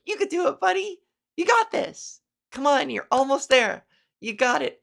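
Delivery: high encouraging cheer; cheering him on. Pretend. Cheering the kid on as he speaks, high and eager. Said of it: "you're like, cheering him on".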